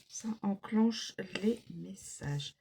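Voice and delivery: soft whisper, almost to herself; whispered aside. Real, not acted. Card reader whispers an aside to viewers, whispering. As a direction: let it slip out under the breath. Aside while reading cards.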